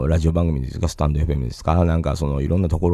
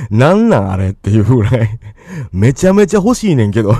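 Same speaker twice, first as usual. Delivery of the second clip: bubbling over with delight at the cute goods; laughing excitedly; 4 semitones higher, 6 dB louder than usual. Real, not acted. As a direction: gush through laughter, voice up and louder. Gushing over cute merchandise. (笑いながら興奮して)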